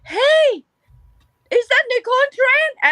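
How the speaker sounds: high, loud shout of recognition; excited fan calling out. Retold or imitated. Fan spotting comedian in West Hollywood, excited shout. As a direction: call out as if spotting a star in the street. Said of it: "I heard a voice say".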